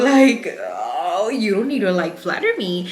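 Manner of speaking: high, loud flustered laughing thanks. Retold or imitated. Cashier answers the compliment with a flustered laugh. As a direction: laugh it off, embarrassed and pleased.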